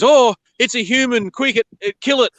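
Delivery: high, alarmed mock cry; panicky snake. Snake spotting a human in the garden, panicky high cry. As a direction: squeal it like a startled snake spotting danger. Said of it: "the one snake goes".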